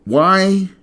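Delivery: high, loud, outraged single word; angry cry. Real, not acted. Host demanding answers from intelligence agencies, angry cry. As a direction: fling the question out in anger. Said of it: "Obviously, you can hear it in my voice".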